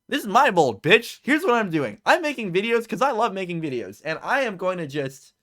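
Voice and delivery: wired, loud, high and fired up; buzzing with energy, almost shouting. Real, not acted. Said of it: "I'm so wired right now".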